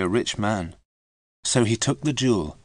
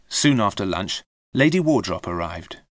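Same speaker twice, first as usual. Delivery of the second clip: usual speaking voice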